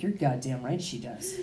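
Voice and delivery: low, quiet, slow mock defiance; low and defiant. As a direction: growl it out low and soft. Retold defiant reply.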